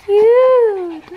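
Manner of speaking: high, loud, drawn-out gushing; cooing. Real, not acted. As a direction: gush slowly over an adorable puppy. Cooing over the puppy.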